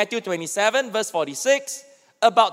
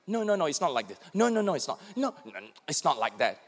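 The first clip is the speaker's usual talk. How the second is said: softer dismissive no no no; impatient correcting; 6 semitones lower, 9 dB quieter, much faster than usual. Acted. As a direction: cut in and correct them, half under your breath. Spouse correcting the other mid-story.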